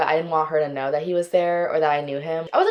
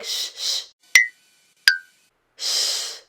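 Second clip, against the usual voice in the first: hushed shh through the teeth; shushing whisper; much slower than usual. Retold or imitated. Guy shushing her while his ex calls, whispered shh. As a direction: shush someone mid-call. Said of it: "he's like".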